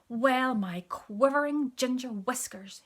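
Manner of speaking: indignant cat. A narrator's reading. Indignant cat's catchphrase.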